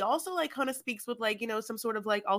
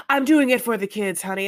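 loud, mock-sincere "I'm doing it for the kids"; louder mock mom's excuse; mock Amanda, louder; 2 semitones higher, 9 dB louder than usual. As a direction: ham up a self-justifying mother. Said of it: "what Amanda's doing is like".

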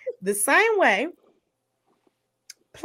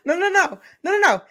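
high, loud no no no; excited protest; 8 semitones higher, 6 dB louder, faster than usual. Real, not acted. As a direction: cut in, all worked up.

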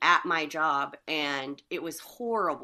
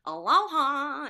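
high, drawn-out mock greeting; sing-song tourist aloha; 8 semitones higher, 3 dB louder, slower than usual. Co-host mocks tourists with a high, slow greeting.

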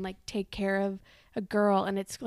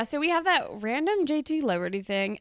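ordinary talk, nothing marked

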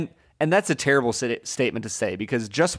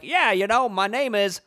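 put-on Boston accent; 8 semitones higher than usual. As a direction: mimic Tom Hanks doing a Boston accent. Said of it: "Tom Hanks is speaking, like, with an accent".

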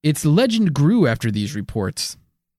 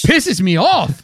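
mock angry; 7 semitones higher, 5 dB louder than usual. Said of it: "I can tell".

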